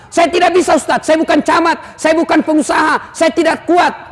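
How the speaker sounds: mock a lazy congregant begging off; whiny excuse.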